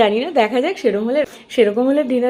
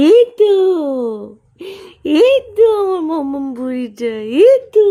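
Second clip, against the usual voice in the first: much higher and louder, squealing with joy; delighted squeal; 7 semitones higher, 5 dB louder than usual. Real, not acted. Mother squealing with delight as her baby smiles.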